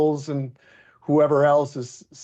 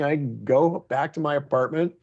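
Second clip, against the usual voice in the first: usual speaking voice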